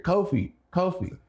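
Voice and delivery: high, drawn-out name call. Retold or imitated. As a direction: imitate someone hailing a friend across an airport. Acts out being called in airport.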